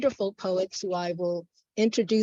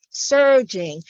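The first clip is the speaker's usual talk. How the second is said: "surging" louder and fuller, falling at the end; swelling repeat; 3 semitones higher, 8 dB louder than usual. Acted. Poet repeating the river's surge, louder declamation. Poem repeats word, building.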